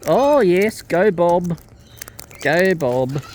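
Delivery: loud cheering for the bird; root for the bird loudly. Real, not acted.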